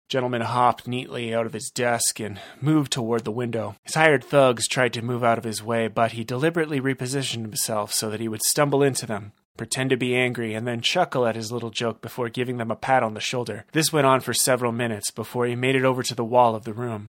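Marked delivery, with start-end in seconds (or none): none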